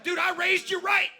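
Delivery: hammed-up proud dad yelling with laughter; proud dad shout. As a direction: cheer like a proud father. Imagining dad's proud reaction.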